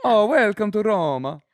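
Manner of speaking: high, effusive Italian welcome; Italian accent. Shown as a demonstration. Italian host welcoming visitors to Roma, Italian accent. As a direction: greet them as a stagey Italian host. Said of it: "an Italian".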